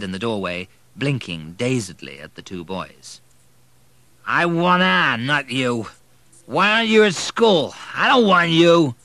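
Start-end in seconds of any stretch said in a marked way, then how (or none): 4.3-8.9 put-on thick slurred drunk. A narrator's reading. Drunk father demanding his dead wife, slurred and loud. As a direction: push the words out thick and slurred, too drunk to care. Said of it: "his voice thick and slurred".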